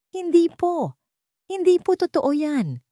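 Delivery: high, pleading denial; desperate. A narrator's reading. Lyra denies the accusation to Ricardo, desperate. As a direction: deny it desperately, pitched well up. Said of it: "desperadong sabi ni Lyra".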